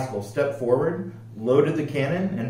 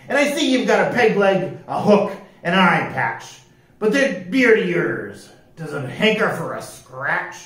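loud pirate captain, sizing up a recruit; 5 semitones higher, 4 dB louder than usual. Acted. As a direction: question him like an old sea dog. Voices pirate captain Pete.

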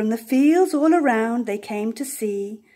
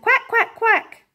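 much higher, squeaky quacking with a laugh; squeaky duck quacks; 11 semitones higher, 4 dB louder than usual. A narrator's reading. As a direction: play the ducks, high and giggly. Ducks' calls, build-up.

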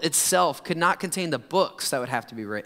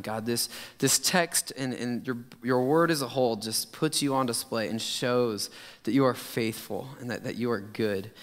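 lower, hushed pulpit prayer; earnest prayer; 4 semitones lower than usual. Real, not acted. Pastor opening the sermon with thanks to God, earnest prayer. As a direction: speak humbly and sincerely to God.

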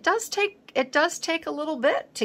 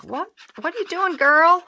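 higher, louder mock outcry from viewers; mock yell; 10 semitones higher, 5 dB louder than usual. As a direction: imitate viewers yelling at you in playful disbelief. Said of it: "You're probably all yelling at me like".

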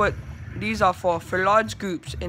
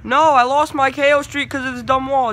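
loud, high complaint; frustrated outburst; 5 semitones higher, 6 dB louder than usual. Real, not acted. Player who just lost his streak, frustrated loud outburst. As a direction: wail it out, exasperated. Loses streak because of a wall.

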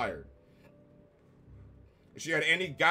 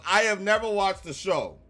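emphatic rant; 3 semitones higher, 8 dB louder, slower than usual. Real, not acted. Reviewer telling viewers no joke landed, yelling. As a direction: hammer each word in disbelief. Ranting that nothing is funny.

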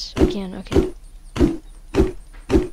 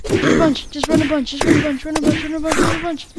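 urgent; 6 semitones higher, 6 dB louder than usual. Real, not acted. Fleeing at low health.

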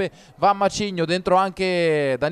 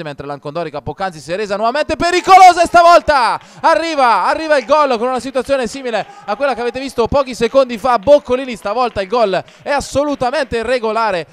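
higher and louder celebration call; excited goal call; 4 semitones higher, 6 dB louder than usual. Real, not acted. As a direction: ride the goal with rising joy. Equalising goal called live.

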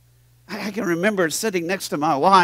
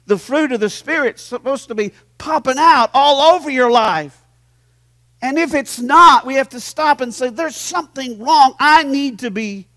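full-force preaching; 9 semitones higher, 10 dB louder than usual. Acted. Pastor urging his church to accept correction, loud preaching. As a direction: preach it hard to the congregation.

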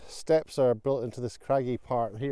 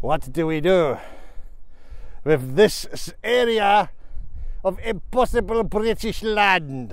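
a mock French accent, slower at the end; 4 semitones higher, slower than usual. Romans puzzling over British land, in a mock French accent. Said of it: "I've just represented Romans in a French accent".

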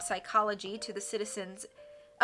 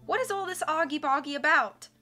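mild cry; 7 semitones higher, 6 dB louder than usual. A narrator's reading. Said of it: "Ishmael cried".

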